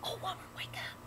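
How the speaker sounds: high frightened whisper; scared whisper. Acted. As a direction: whisper urgently, terrified. Man whispers to wife in fear.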